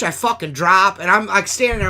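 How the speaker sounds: ordinary delivery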